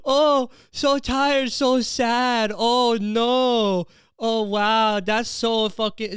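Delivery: put-on high, slow, sing-song baby voice; sarcastic pity in a high cooing voice; mocking baby talk. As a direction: coo mockingly at your own whining self. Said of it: "mocking myself".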